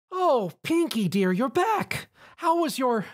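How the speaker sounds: very high, slow kindly greeting; sweet motherly baker. Acted. Missus Cake greets Pinkie at the counter, high and kindly. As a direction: greet her fondly.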